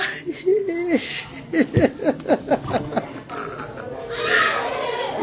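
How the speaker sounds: a long laugh kept soft; long delighted laugh. Real, not acted. Mother delighted by her son, soft laugh. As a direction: laugh softly for a long while.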